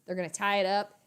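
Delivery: ordinary talk, nothing marked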